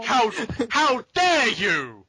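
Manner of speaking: loud, high, slow indignation; outraged father. Acted. Father scolding his lovestruck daughter, hammed-up outrage. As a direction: thunder it word by word, hammed-up.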